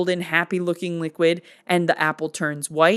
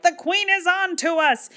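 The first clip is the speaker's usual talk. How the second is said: alarmed Wednesday; 12 semitones higher than usual. Retold or imitated. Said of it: "Wednesday's like".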